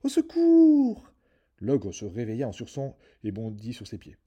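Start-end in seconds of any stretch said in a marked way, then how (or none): 0.0-1.1 shrieking, much higher, very loud cry for help; screaming harp. A narrator's reading. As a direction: scream the alarm at the thief. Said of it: "la harpe se mit soudain à crier".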